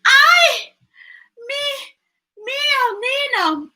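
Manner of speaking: mother wailing that her son may drown; frantic crying out. A narrator's reading. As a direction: cry out the names in alarm. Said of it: "Mama cried".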